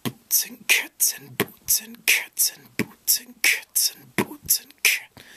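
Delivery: breathy percussive beatbox whisper, slow; slow whispered boots and cats beat. Shown as a demonstration. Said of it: "what it would sound like is".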